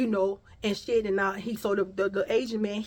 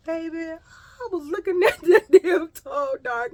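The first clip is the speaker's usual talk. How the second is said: laughing; 7 semitones higher, 8 dB louder, slower than usual. Real, not acted.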